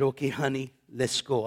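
usual speaking voice

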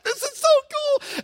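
high-pitched thrilled squeal; excited. Retold or imitated. Retelling his thrill at riding along, excited. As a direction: act out giddy excitement, way up high. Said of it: "I was excited".

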